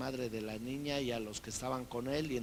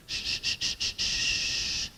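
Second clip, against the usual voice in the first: loud unvoiced shushing hiss; devil's shushing; 8 dB louder, much slower than usual. The devil lulls Bible readers to sleep, loud hushing. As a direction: shush them to sleep like a tempter. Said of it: "Hasta el diablo te hace".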